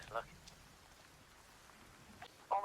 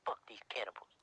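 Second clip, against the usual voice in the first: hushed whisper; 3 semitones lower, 5 dB quieter than usual. Real, not acted. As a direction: whisper it so they can't hear. Hiding from cannibals.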